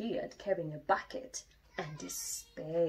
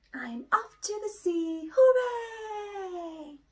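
higher, loud excited shout; excited cheer; 12 semitones higher, 6 dB louder, slower than usual. Acted. Little Bear cheers about going to the sea, loud and high. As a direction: whoop with a cub's joy.

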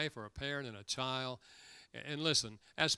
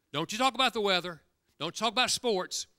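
louder and higher, hammering don't you talk; emphatic repeat; 8 semitones higher, 8 dB louder than usual. Retold or imitated. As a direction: insist the second time, pressing the warning. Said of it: "that's what men talk about".

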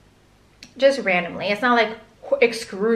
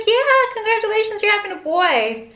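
much higher, sing-song congratulations; high, bright-toned mock cheer for the mom-to-be; cheery ultrasound tech; 11 semitones higher than usual. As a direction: imitate a bubbly nurse breaking the news. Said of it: "She's like".

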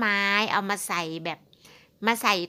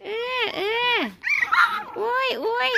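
much higher laughing squeals; squealing delight; 10 semitones higher than usual. Real, not acted. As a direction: squeal with delight at the noisy dogs. Squealing at howling dogs. (เสียงแหลมสูงหัวเราะดีใจ)

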